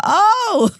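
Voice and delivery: high loud shocked cry. Retold or imitated. Finding out who Weirdo was, a high loud Oh. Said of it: "I was like".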